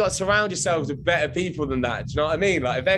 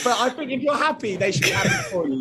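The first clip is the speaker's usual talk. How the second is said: laughing; 8 semitones higher than usual. Real, not acted. Laughing while saying it.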